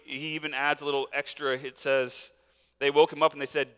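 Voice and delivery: ordinary talk, nothing marked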